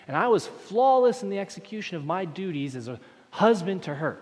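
a preacher listing a husband's flawless chores, rising and loud; preaching at full force. Acted. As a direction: pile the chores up to a peak. Preaching builds.

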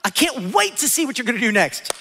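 high, loud, eager offering; cheerful giver. Acted. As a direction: bubble over with acted cheer. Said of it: "So be cheerful".

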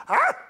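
sharp high mock yelp; dog yelp. The preacher imitating Honey yelping when whacked. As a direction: yelp like the hard dog taking the whip. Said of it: "Honey'd go".

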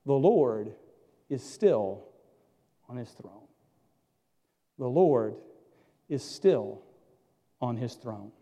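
soft, low, paced refrain; quiet assurance that the Lord is still on his throne. Acted. Repeated slow refrain.

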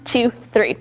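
usual speaking voice

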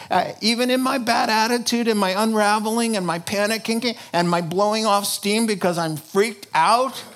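loud, high fervent preaching. Acted. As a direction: preach it with rising fervor. Preaching at full force.

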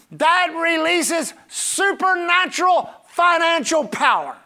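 slow, high proclamation dropping on the last word; full-force preaching. Real, not acted. Preacher promising power to the congregation, slow forceful preaching. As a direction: hammer out each word to the congregation.

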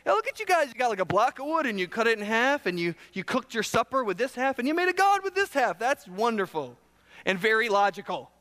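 sarcastic divine taunt. Acted. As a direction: praise it with heavy sarcasm. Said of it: "it's sarcastic. God says".

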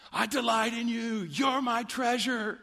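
high, ecstatic voice of devotion; rapturous delight. Acted. Pastor voicing the psalmist's delight in God, rapturous and high. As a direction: gush it up high with wonder.

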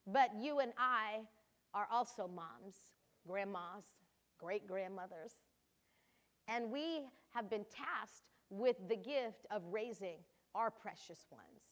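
ordinary delivery

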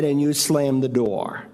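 ordinary talk

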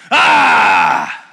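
sudden loud low outburst; mock angry shout. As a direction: explode all at once. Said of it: "seven hours later".

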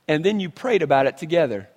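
normal talking voice

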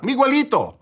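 mom calling out, pitch leaping high; high-pitched mock yell of a name; retold yell. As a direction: imitate a mother's high, urgent call. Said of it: "my mom yelled out".

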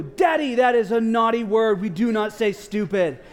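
put-on gleeful kid's gotcha shout; child's scolding shout. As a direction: shout it like a five-year-old catching his dad. Son catching dad in own rule.

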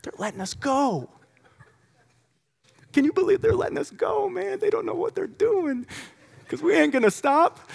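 very high, squeaky excited aside; giddy disbelief. Acted. Freed apostles to each other as they walk out, giddy disbelief. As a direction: squeal it in amazed, conspiratorial glee. Acting Peter and John just released.

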